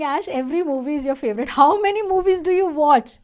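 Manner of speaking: mock a student's playful jab; imagined student teasing. Teacher voicing a student who teases her about favorites, playful and rising. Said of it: "you must be thinking".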